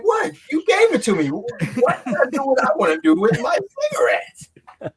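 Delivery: protest, half laughing; mock indignation. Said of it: "I'm like".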